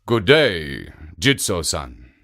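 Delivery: low ogre's voice; ogre greeting. A narrator's reading.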